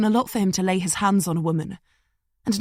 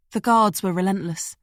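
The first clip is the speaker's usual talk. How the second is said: ordinary talk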